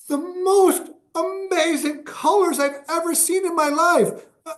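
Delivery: high, amazed woman's voice; high-pitched amazed gushing; awestruck woman. Retold or imitated. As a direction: gush in wonder as the tripping woman. Quoting awestruck woman on DMT.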